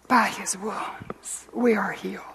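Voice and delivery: soft, slow, breath-filled declaration; hushed reverence. Real, not acted. Preacher assures listeners of healing, hushed and reverent. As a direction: say it slowly, almost whispering. Solemn climax of the sermon.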